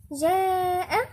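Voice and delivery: جاء with a long held "aa"; madd elongation. Shown as a demonstration. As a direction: stretch the vowel of جاء before the glottal stop. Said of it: "ده حرف مد".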